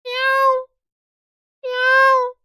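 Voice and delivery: high squeaky cat meow. Shown as a demonstration. Teacher makes a cat sound for students to guess, high mewing. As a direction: mew like a kitten, high and thin. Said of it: "मैं एक आवाज निकालूंगा".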